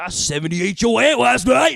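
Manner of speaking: put-on high, loud crude kid bragging; loud kid boast. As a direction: belt it out like a proud kid. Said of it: "so I told her, I was like".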